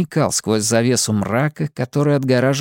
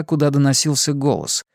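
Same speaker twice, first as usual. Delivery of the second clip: usual speaking voice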